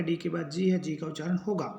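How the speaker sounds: normal talking voice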